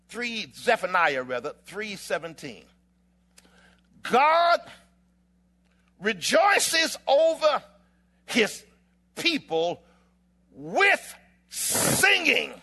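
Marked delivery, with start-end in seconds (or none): 4.0-12.5 loud, high, drawn out with long pauses; slow, ringing pulpit declaration; dramatic scripture proclamation. Real, not acted. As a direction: proclaim it one phrase at a time. Proclaims verse slowly, then repeats it.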